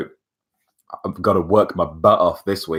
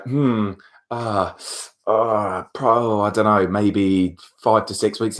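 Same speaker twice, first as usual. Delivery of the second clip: higher, drawn-out hemming and hawing; hesitant mimicry; 4 semitones higher, slower than usual. Unsure applicant answering the interviewer, hesitant. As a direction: imitate someone dithering over an answer.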